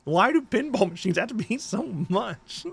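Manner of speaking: high-pitched whine breaking into a laugh; exasperated lament. Real, not acted. Host lamenting pinball machine prices, high exasperated whine. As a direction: wail the question, half laughing.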